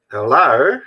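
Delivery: one-word Aussie greeting, rising sharply; Australian accent. Shown as a demonstration. Vlogger demonstrating an accent in one word, Australian accent. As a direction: do Australian with a big question-mark lilt. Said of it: "Australian accent with one word".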